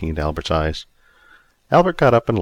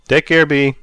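calling out; 7 semitones higher, 6 dB louder than usual. A narrator's reading. Bus driver calling goodbye to Bea, a friendly call. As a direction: friendly call across the street. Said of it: "Stanley called out".

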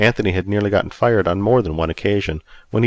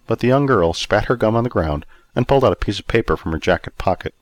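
usual speaking voice

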